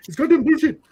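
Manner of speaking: high, loud mock outburst; retold indignant yell; mad clerk. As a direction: imitate an angry man boiling over. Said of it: "he starts getting mad at me".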